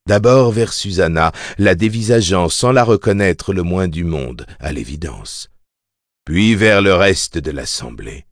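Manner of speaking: ordinary delivery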